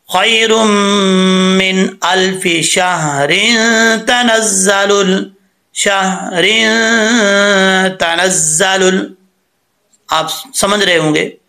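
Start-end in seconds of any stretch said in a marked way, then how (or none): 0.1-9.2 slow, melodic tajweed recitation joining शहरिन to तनज़्ज़लुल; Quranic recitation. Shown as a demonstration. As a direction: recite it slowly, running on without a stop. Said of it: "कैसे?". (बिना वक़्फ़ के धीमी तिलावत)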